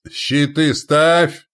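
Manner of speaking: loud, high, drawn-out battle order; barked command. Acted. Commander to his shield line, barked command. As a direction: roar the order slowly. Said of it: "рявкнул Лонни".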